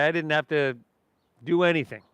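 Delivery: ordinary talk, nothing marked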